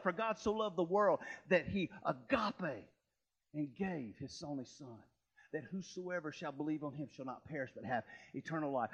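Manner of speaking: low, soft, reverent recitation of a verse; solemn scripture reading. Acted. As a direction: lay the verse down gently, like something holy. Quoting John 3:16 in sermon.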